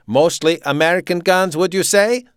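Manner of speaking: loud, high German officer; authoritarian, punched-out German accent; staccato German accent. A narrator's reading. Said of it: "he spoke with a staccato German accent".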